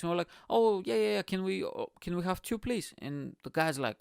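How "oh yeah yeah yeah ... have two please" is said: hammed-up naive politeness; overly cheery request. Retelling asking for tickets.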